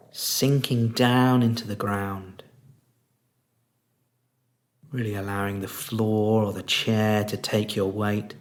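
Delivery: soft, unhurried meditation voice with long pauses; guided meditation. Real, not acted. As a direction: guide them gently into stillness, leaving space between phrases.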